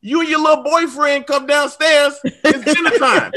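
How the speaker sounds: high, loud mom calling upstairs; mom yelling upstairs. Retold or imitated. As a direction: yell it up the stairs like his mom. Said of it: "she screams upstairs".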